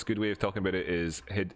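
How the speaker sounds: usual speaking voice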